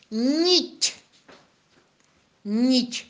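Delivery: clear, drawn-out dictation; dictating. Shown as a demonstration. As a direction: dictate "Нить" slowly. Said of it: "запишите слово нить".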